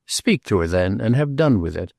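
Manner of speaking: plain reading voice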